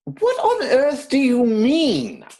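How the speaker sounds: high, slow and loud in disbelief; as if the idea were outrageous; incredulous. Acted. Jack to Algernon, caught out, in loud high disbelief.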